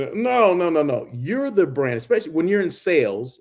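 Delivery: ordinary delivery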